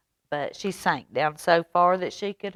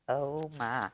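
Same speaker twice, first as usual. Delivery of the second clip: ordinary delivery, nothing marked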